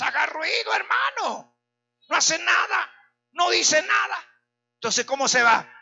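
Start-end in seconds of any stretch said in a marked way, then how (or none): 0.0-4.2 shouting. Real, not acted. Preacher urges the church to make noise, shouting high. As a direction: shout it up high to rouse them.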